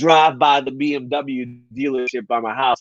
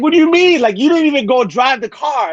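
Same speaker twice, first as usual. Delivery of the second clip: high, louder, baffled sales guy; surprised salesman; 12 semitones higher, 4 dB louder than usual. Retold or imitated. Salesman confused that the customer didn't even drive, high and louder. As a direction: play Terrence thrown off by the sudden decision. Terrence voiced in a retold story.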